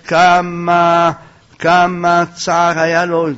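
loud, slow dwelling on כמה; drawn-out emphasis. A narrator's reading. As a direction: stretch each כמה long on a held, chant-like note. Preacher dwells on the Gemara's wording.

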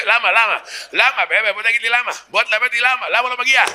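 high, insistent mock protest; indignant demand. Comedian protesting the unequal budgets to the crowd, indignant. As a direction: keep demanding why, pitched well up.

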